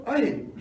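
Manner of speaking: "Ahit", with h where j belongs; mispronounced name. Retold or imitated. Recalling his complaint to the girl, mispronounced "Ahit". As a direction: quote the wrong "Ahit", h replacing the j of [name]. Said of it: "don't pronounce my name".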